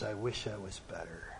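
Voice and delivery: put-on faint, broken whisper of an old man; low broken voice. Old man whispering to the crowd, low and broken. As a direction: barely voice it, worn out and frail. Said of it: "said in a really low, broken voice".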